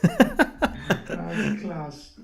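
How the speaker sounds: high, loud laughing exclamation. Real, not acted. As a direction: burst out laughing. Cracking up at joke.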